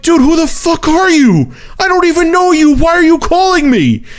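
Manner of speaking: high-pitched, incredulous angry rant; exasperated outburst. Retold or imitated. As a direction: re-enact losing patience with an unwanted caller. Acted exasperated retort to stalker.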